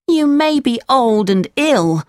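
put-on high-pitched puppet boy voice; Pinocchio, defiant. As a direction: scold them as a high-voiced little puppet.

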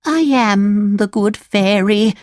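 put-on drawn-out old woman declaring herself; old fairy, crying out. A narrator's reading. Old woman reveals herself to Pinocchio, crying out slowly. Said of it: "cried the old woman".

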